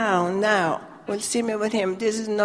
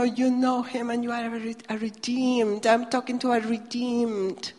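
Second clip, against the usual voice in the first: normal talking voice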